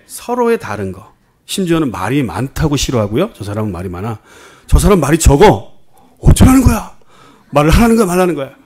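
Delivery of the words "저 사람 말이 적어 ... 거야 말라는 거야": higher, louder, mock-indignant complaint; exasperated mock rant rising to a shrill question; mock exasperation. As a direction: play a fed-up churchgoer griping, hammed up. Mocking a complaining churchgoer.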